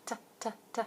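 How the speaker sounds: soft repeated tuh sounds; letter sound T. Shown as a demonstration. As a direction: sound out T, T, T lightly. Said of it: "T makes this sound".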